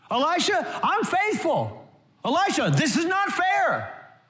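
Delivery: demanding complaining woman. Retold or imitated. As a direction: play an entitled widow shouting at Elisha. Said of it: "The woman does not say".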